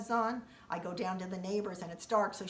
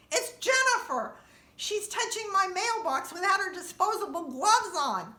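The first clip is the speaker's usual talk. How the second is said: high, loud indignant cry; outraged shout; 11 semitones higher, 8 dB louder than usual. Retold or imitated. Telling the neighbor about the mail lady, indignant shout. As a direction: shriek out the accusation.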